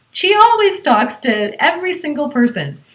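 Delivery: ordinary talk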